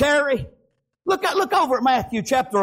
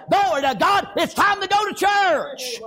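high, slow-stretched exultant cry; joyful shout; 8 semitones higher than usual. Acted. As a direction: shout it out like a glad churchgoer. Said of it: "come out there with a shout".

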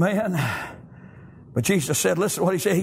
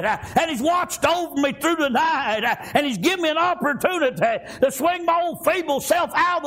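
raised, rhythmic testifying with gasped "uh"; full-force preaching; 6 semitones higher than usual. Real, not acted. Preacher thanking God for another morning, full-force preaching. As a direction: build into a driving preacher cadence.

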